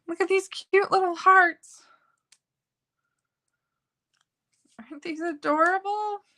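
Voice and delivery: high, cooing delight; gushing. Real, not acted. Crafter showing viewers her little hearts, high gushing coo. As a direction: coo over the tiny hearts.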